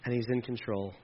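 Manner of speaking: low, hushed, unhurried assurance; soft closing preaching. Acted. Pastor closing the sermon to the church, hushed and assuring. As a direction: settle them softly into trust.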